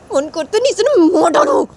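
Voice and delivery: high, loud burst; sudden outburst. Acted. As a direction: blurt it out at full pitch. Sanju bursts out. (ऊँची, तेज़ चीख)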